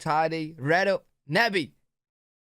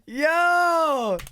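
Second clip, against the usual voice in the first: high, loud whoop of "Yo!"; excited shout; 10 semitones higher, 6 dB louder than usual. Real, not acted. Learner thrilled by the new accent, excited shout.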